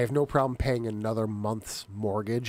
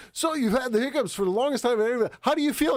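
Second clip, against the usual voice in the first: higher, bright news-interviewer voice; 10 semitones higher than usual. Retold or imitated. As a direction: ask it like a TV reporter. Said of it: "He's like".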